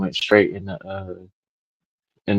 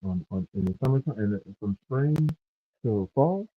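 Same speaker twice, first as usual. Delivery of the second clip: muffled; 12 dB quieter than usual. Real, not acted. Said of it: "you sound muffled".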